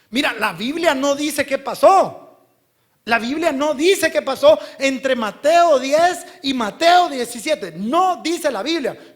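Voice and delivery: loud, high, emphatic sermon delivery; full-force preaching. Real, not acted. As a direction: drive it home to the congregation. (prédica a toda voz)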